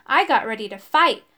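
usual speaking voice

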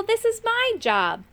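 high, insistent call; high-pitched protest. A narrator's reading. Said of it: "called Moon Gobble".

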